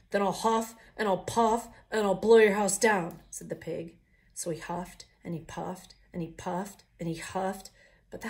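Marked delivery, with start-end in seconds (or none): 0.1-3.2 loud, menacing threat; the pig roaring at the wolves; pig's threat. Acted. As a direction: bellow the threat.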